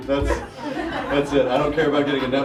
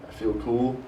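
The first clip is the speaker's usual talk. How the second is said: ordinary talk, nothing marked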